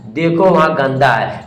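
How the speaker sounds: ordinary talk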